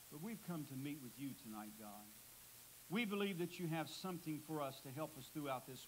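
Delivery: soft, hushed prayer voice; bowed-head pastor's prayer. Real, not acted. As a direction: pray quietly and earnestly to God. Closing prayer after the sermon.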